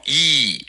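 E held out as a long "eee"; long vowel. Shown as a demonstration. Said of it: "是一个长音".

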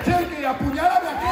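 high loud teasing come-on. Retold or imitated. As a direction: tease Chucky with a tipsy dare. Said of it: "y la otra tía".

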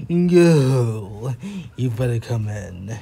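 loud groan falling to a low grumble; muttered reluctance. Acted. Mister Fussy lets the unwanted cousin in, reluctant grumble. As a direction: start with a long "No", then give in. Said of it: "he muttered".

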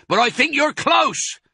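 very high, loud holler; yelled reply across a distance; shouted. A narrator's reading. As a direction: shout the answer back. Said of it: "shouted Boggis".

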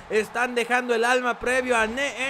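usual speaking voice

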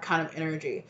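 ordinary delivery

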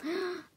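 sharp intake of breath; anticipatory gasp. Real, not acted. Opening a friend's Christmas present, excited gasp. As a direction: gasp before opening the gift.

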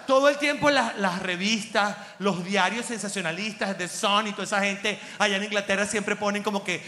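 ordinary talk

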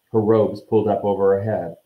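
plain reading voice

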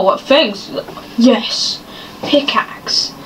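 normal talking voice

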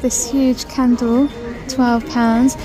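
ordinary talk

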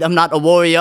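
impassioned slam poetry outburst; emphatic declaration. Acted. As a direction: push it out like a confession. Climax of poem, loud.

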